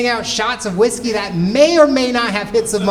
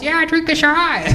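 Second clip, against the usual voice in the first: a slower, higher, different crowd voice egging him on; another disguised voice; 7 semitones higher than usual. Pretend. Comic playing a second fake audience member egging his friend on, in a slower, higher voice. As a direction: sound like a second stranger, drawn out and higher. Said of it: "changing my voice".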